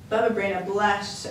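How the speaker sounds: ordinary delivery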